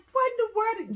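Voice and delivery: shocked at a strange noise; mock startled what. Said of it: "I'm looking around like".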